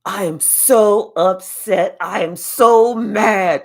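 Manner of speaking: loud, slow hammed-up fury; mock upset. Jealous ex whining to friends, loud and slow mock anger. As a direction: drag out each word in mock anger. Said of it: "Somebody's like".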